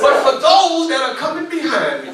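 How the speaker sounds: rally the crowd, shouting each phrase; rousing call and response. Shown as a demonstration. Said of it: "repeat after me".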